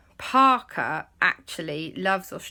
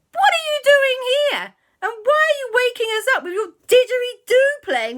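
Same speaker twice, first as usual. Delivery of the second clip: very high, loud indignant yell; exasperated scolding; 7 dB louder than usual. Acted. Friends scolding Andy for waking them, high indignant yell. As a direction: play an outraged squawk.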